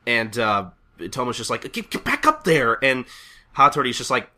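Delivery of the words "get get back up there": flustered order. Acted. Boss orders ninja back up.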